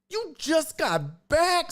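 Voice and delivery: stretched out and squeaky high; teasing Cece for another vacation, drawn out in disbelief; high, slow disbelief. Real, not acted. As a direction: drag each word out in mock shock. Exaggerated disbelief at friend.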